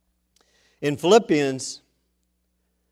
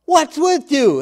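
raised, high angry voice; storyteller's acted angry yell; angry shouting; 11 semitones higher, 8 dB louder, slower than usual. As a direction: scold the dog loudly. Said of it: "an angry man starts shouting at the dog".

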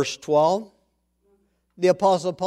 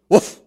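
short, loud imitated woof; one sharp dog bark; quick loud "woof"; 9 dB louder than usual. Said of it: "barks the dog".

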